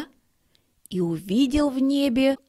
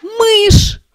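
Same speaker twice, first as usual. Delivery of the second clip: much higher, much louder scream; terrified shout; 14 semitones higher, 13 dB louder than usual. A narrator's reading. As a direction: elephant panics at a mouse. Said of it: "закричал слон". (испуганный крик слона)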